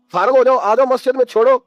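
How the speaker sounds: mock exhortation. Mocking advice to elders.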